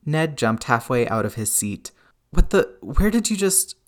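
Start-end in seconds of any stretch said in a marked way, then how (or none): 2.3-3.8 high, loud, startled sputter. A narrator's reading. Ned as Hawkeye drops from the vents, startled. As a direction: sputter it in alarm, pitch shooting up.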